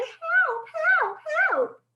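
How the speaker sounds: shrill high cries of help; squealing help, help, help; mock yelling. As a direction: play the panicking teacher.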